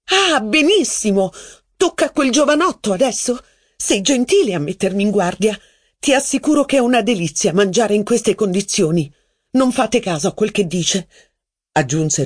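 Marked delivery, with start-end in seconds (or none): 0.1-11.1 high, mocking angry voice; sarcastic outburst. Mistress flaring up sarcastically at Robert. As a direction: sneer it in a fit of temper. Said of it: "diede in escandescenze".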